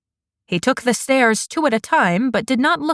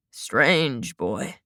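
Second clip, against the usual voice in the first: much lower and softer, trailing away; fading murmur; 9 semitones lower, 6 dB quieter than usual. A narrator's reading. Old man repeats it to himself, fading low murmur. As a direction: let it sink low, almost to himself.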